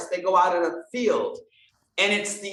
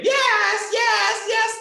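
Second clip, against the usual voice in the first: the universe answering; 13 semitones higher, much slower than usual. Retold or imitated. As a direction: voice the universe saying yes, big and high. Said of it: "And the universe say".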